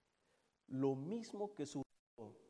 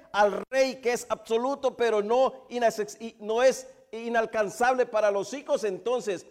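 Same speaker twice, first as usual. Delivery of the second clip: loud, high, driving sermon voice; fervent preaching; 7 semitones higher, 14 dB louder than usual. Real, not acted. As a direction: preach it out at full force to the congregation. Preaching at full force.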